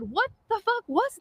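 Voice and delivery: high, stunned swearing question; retold astonishment, pitched well up; shocked outburst. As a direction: cry it out at the sky in disbelief. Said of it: "I looked up and said".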